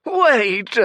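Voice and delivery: high, loud shrieked plea; desperate old priest shrieking at the girl. Acted. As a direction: shriek to stop her leaving. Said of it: "he shrieked".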